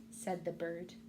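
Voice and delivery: plain reading voice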